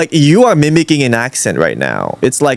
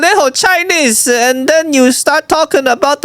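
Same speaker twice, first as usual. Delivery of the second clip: hammed-up Chinese accent; 13 semitones higher than usual. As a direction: complain in an exaggerated high Chinese accent.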